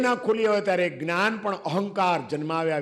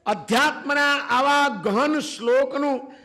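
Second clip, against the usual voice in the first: blunt rebuke; 6 semitones higher than usual. Acted. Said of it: "આનંદગંજી કહે છે".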